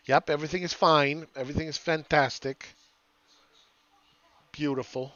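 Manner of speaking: put-on old lady accent, West Palm Beach retiree cooing. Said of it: "That's an old lady accent".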